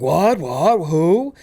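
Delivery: high, loud disbelieving cries; rising, incredulous outbursts; mock astonishment. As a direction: yelp it in exaggerated shock. Acted astonished reaction in retold plot.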